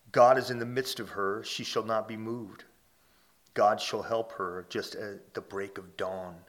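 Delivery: measured, ringing scripture read. Acted. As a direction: read it out as holy writ. Reads scripture aloud.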